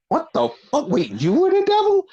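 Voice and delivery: rising to a high, stunned question; mock shocked fan. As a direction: act floored by the reveal. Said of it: "everybody would be like".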